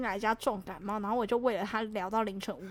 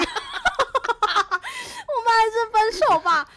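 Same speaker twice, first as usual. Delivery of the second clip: laughing through tears; 12 semitones higher, 11 dB louder than usual. Real, not acted. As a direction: break up the words with helpless laughter. Laughing-crying retelling breakup.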